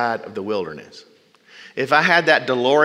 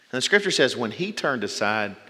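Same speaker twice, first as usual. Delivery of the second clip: ordinary talk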